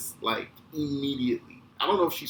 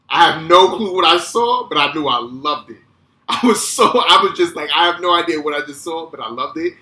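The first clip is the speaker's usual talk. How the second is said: loud, raised-pitch amazement; thrilled outburst; 6 semitones higher, 14 dB louder than usual. Retold or imitated. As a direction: burst out like an awestruck, hooked kid. Reliving being hooked by the film.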